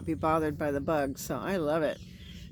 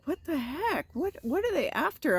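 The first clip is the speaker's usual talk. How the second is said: high, baffled what the heck; baffled surprise; 10 semitones higher than usual. Retold or imitated. Finding an ant hill at her tent, high baffled surprise. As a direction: react like the ants appeared from nowhere.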